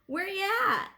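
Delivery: high parade call. A narrator's reading. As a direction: throw it out to the street.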